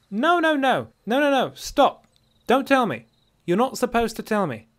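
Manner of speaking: high, loud, insistent she-elf; exasperated dark elf woman. A narrator's reading. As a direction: cut the stranger off sharply. She-elf cuts the hunter off.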